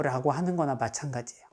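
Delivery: usual speaking voice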